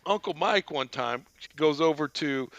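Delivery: normal talking voice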